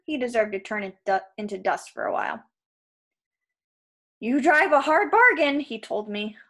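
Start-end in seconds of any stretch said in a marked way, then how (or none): 4.2-5.6 high, loud wheedling; panicked bargaining. Acted. Krusty bargaining with Percy, loud and desperate. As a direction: haggle for your life. Trapped giant bargains for life.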